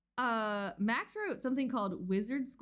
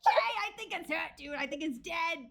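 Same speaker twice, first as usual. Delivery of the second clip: put-on Boston accent; 9 semitones higher, 4 dB louder than usual. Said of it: "the Boston sunfish guy accent".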